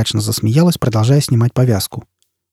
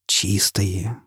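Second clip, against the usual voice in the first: soft, hushed "Чистые" with a lift; awed hush; 3 semitones lower, 8 dB quieter than usual. Acted. Foyle sees his clean face, hushed and awed. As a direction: breathe it in wonder. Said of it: "прошептал Фойл".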